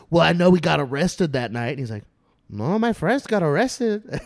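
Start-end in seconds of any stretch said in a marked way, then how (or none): 0.1-1.7 loud, insistent retold accusation; heated mock insistence; angry man. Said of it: "confused and angry". 2.5-4.2 high, loud, slow Mexican-accented imitation; drawn-out Mexican-accented denial. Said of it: "like, an old Mexican man".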